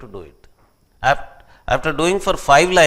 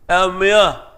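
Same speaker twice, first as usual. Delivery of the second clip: mock street call; 3 semitones higher, 5 dB louder, much faster than usual. Imitating street greeting.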